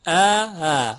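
ا، ا with a loud hard first, smooth second; strong glottal onset, then a slack one on ا، ا; firm then eased hamza. Shown as a demonstration. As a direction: strike the first ا, loosen the second.

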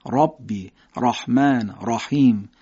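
ر in رحمان with its vowel darkened toward "ro"; thick, full r in ربی and رحیم; heavy ra. Shown as a demonstration. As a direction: say رحیم as "rahim" with a dark, alef-like a.

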